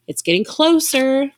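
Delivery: high, loud and slow, fear rising; frightened cry. A narrator's reading. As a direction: cry out as the tanker nears. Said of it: "Isabel cried".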